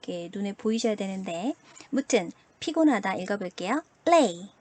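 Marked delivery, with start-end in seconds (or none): 4.1-4.5 레이 with a clear "ei" glide; loud model reading of 레이; "ei" vowel sound. Shown as a demonstration. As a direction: voice 레이 loudly, gliding from e to i. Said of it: "에이 소리가 나네요".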